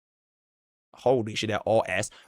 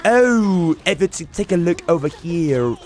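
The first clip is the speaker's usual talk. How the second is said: mock British accent; 2 semitones higher than usual. Shown as a demonstration. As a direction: play a posh British commentator badly on purpose. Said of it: "If you have the British accent, it's like".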